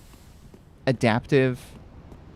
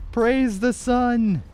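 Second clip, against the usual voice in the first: high, drawn-out heroic cry; hammed-up slow cry of praise; battle cry; 12 semitones higher, much slower than usual. Hammy game battle cry.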